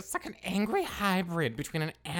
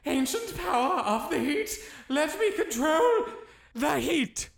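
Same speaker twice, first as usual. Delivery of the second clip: high, loud chanted invocation; spell incantation; 8 semitones higher, 4 dB louder than usual. Acted. Wizard retrying the fire spell, incanting high. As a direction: declaim the spell with full force. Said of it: "Okay, okay, I've got it".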